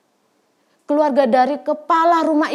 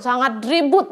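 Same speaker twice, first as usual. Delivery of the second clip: ordinary delivery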